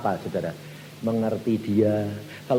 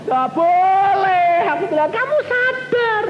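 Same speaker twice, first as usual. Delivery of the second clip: emphatic exclamation; 6 dB louder than usual. Acted. Preacher exclaims rebuke.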